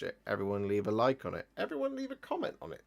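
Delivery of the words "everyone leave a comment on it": rushed comic outro. Acted.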